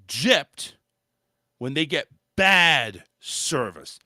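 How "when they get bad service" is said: bad hit hard and drawn out, slow; stress on bad. Real, not acted. Tipping rant, stressing bad service slowly. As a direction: lean on the word bad. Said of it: "I stress the word".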